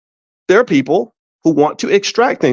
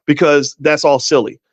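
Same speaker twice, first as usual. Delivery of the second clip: ordinary talk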